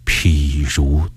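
low, slow and weighty; heavy, grave tone. A narrator's reading. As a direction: pronounce a final break with the past, slowly and gravely. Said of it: "语气沉重地说".

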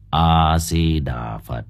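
low, slow solemn chant; deep calm monk. A narrator's reading. Old monk greets the villagers, low slow intoning. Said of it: "một giọng nói trầm ấm".

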